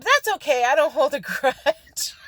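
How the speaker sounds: bright, sweet retort with a laugh on grudge; breezy retort. Retold or imitated. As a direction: brush it off with sugary politeness. Retorts to a disliked agent.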